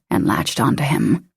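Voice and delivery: plain reading voice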